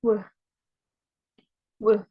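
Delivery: W as a short bare glide sound; brief W sound, repeated after a pause; W sound demo. Shown as a demonstration. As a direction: model the sound of W, not the letter name. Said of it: "W sound".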